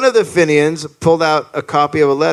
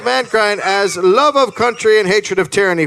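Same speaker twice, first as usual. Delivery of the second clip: higher-pitched, rising declamation; letter read aloud; 5 semitones higher than usual. Acted. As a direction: build up a stirring proclamation. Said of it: "this is the letter he read".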